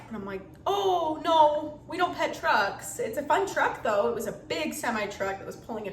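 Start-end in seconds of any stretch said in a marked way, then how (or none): 0.7-3.0 put-on higher, slow, sing-song no to a toddler; gentle mom refusal. Mom telling her toddler they don't pet trucks, slow and gentle.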